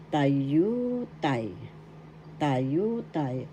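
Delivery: tayutay with YU drawn out and stressed; teacher's model of tayutay, long accented YU; stress on second syllable. Shown as a demonstration. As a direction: stretch the middle yu of tayutay. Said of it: "The second syllable has the accent".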